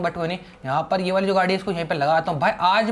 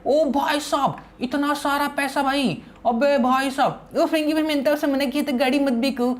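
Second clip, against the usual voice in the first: childish cartoon-boy voice, a little higher; Sinchan voice; 7 semitones higher than usual. Sinchan teasing his buddy Franky, in a childish cartoon voice. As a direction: play the cheeky cartoon kid.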